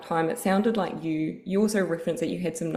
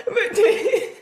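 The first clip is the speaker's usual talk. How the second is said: laughing; 7 dB louder than usual. Real, not acted. Laughing at the gumboot joke.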